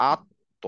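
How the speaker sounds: আত্ম with a little bounce, lips rounded; bouncing ta. Shown as a demonstration. Teacher models the sound for students, rounded আত্ম. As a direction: let আত্ম bounce off the tongue. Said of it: "একটু bouncing হয়".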